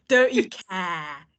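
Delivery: slow try at a London accent; attempted London accent. Shown as a demonstration. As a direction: carefully copy a Hackney accent, vowel drawn out. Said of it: "I'm gonna try this out here".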